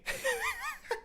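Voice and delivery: high, quiet wheeze of laughter; real stifled laughing fit; wheezing laugh. Real, not acted. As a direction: crack up helplessly. Cracks up at the abrupt switch.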